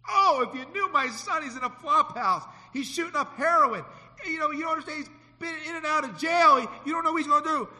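high, loud pleading parent; anxious parent. Retold or imitated. Parent complaining about their addict son, high and loud. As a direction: plead as a worried mother.

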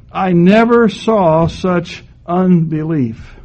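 slow, weighty "I never saw such unbelief."; slow emphatic. Retold or imitated. Preacher quoting visiting pastors, slow and emphatic. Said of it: "they would almost always look at me and say".

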